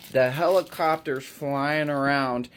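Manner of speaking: ordinary delivery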